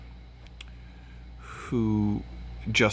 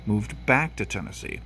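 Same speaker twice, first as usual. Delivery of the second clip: ordinary delivery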